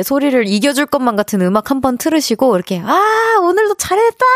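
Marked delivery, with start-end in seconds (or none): 2.8-4.2 high, ringing cheer to yourself; shouting. Shown as a demonstration. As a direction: shout it out in relief. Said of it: "이렇게 한번 외쳐주세요".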